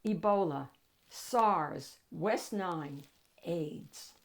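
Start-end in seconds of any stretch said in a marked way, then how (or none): none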